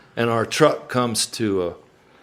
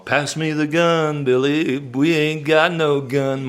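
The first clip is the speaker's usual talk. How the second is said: drawn-out song-hook delivery; slow punchline; 6 semitones higher than usual. Shown as a demonstration. Teller sets up his song's punchline, drawn out slowly. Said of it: "it's all gonna be in the song".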